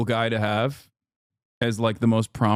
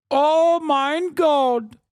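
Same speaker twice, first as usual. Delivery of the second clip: very high, drawn-out mock German swoon; German accent; 13 semitones higher, 3 dB louder, much slower than usual. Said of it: "Germans look at an accountant wearing a turtleneck and go".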